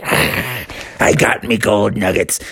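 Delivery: low, slow cat growl; low slow saber cat. Acted. Saber cat gloating with the nugget in his teeth. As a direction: purr it out like a smug big cat.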